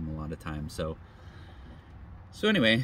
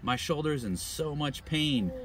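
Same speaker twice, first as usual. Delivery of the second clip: complaining stranger; 8 semitones higher than usual. Retold or imitated. Stranger complains of shoulder pain.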